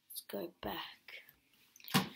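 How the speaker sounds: slow sneaky whisper; drawn-out whisper. Real, not acted. Sneaking in the dark.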